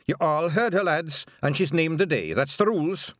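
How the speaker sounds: rally the whole crowd; shouting to the lads. A narrator's reading. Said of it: "Rob Anybody shouted".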